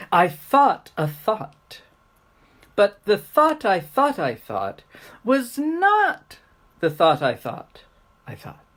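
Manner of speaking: emphatic, carefully articulated tongue twister; speech exercise. Shown as a demonstration. Teacher demonstrating a speech exercise to little kids, emphatic recitation. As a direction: punch the key words with big pitch swings.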